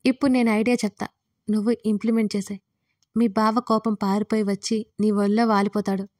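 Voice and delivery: usual speaking voice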